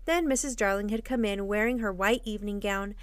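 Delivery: usual speaking voice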